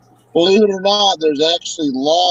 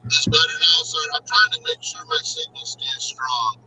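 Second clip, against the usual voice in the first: choppy, breaking-up call audio; robotic voice through a bad connection; muffled robot; 4 dB quieter than usual. Real, not acted. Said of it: "muffled robot almost".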